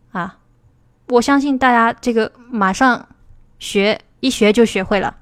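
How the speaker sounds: normal talking voice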